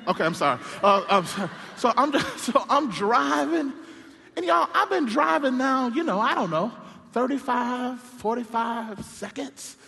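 ordinary talk, nothing marked

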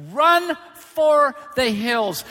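loud, high, slow yell; drawn-out mock-urgent shout; shouted warning. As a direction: holler each word as a warning.

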